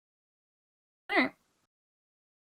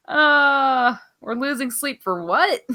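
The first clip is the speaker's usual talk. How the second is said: ordinary talk, nothing marked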